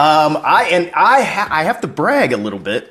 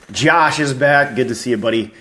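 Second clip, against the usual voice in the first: ordinary delivery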